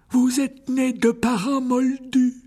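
put-on high, slow frail old wizard; old headmaster voice. A narrator's reading. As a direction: greet him like a frail elderly headmaster. (voix aiguë et lente de vieux directeur)

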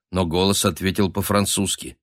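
ordinary delivery

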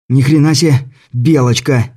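gasping exclamation while running; panting it out mid-chase; out of breath. Acted. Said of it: "сбивая дыхание".

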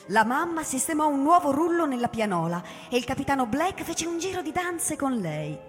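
normal talking voice

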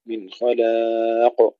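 خلاق with a thick kha and a thin lam, slowly; heavy kha, light lam. Shown as a demonstration. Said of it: "تفخيم الخاء، ترقيق اللام". (تفخيم الخاء وترقيق اللام في خلاق)

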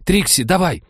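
high, loud desperate shout; urgent shout. A narrator's reading. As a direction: push her with a shout. Technologist urges Trixie in panic.

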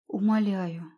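ordinary delivery

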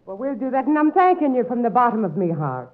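stage-Irish brogue, coaxing; put-on Irish accent. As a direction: sweet-talk him in an Irish lilt. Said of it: "picked up that accent".

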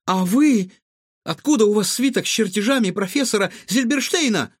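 high, insistent questioning; stern. A narrator's reading. Boy questions the cook about stolen blueprints, stern. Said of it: "строго спросил Егор".

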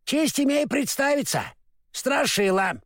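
high, eager scarecrow; lightly voiced straw scarecrow. A narrator's reading. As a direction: introduce yourself proudly.

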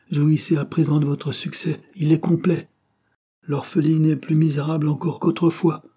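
high, emphatic bitter irony; bitter reproach. A narrator's reading. Orphan girl blaming suitor for her misery, bitter reproach. She bitterly concedes his success.

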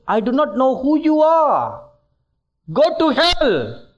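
stern voice of God. Retold or imitated. God rejecting people at judgement.

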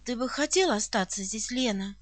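low, soft, serious question. A narrator's reading. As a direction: ask gravely and quietly. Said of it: "спросила очень серьёзным тоном". (очень серьёзным тоном)

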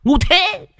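high mocking jeer, then a laugh; mocking cackler. (giọng the thé chế nhạo)

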